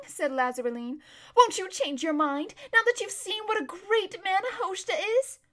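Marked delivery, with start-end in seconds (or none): 1.4-5.4 high, gushing appeal; coaxing. Acted. Lazareline urging her friend to accept Hoshta, high coaxing plea. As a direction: wheedle her, in admiration of the suitor.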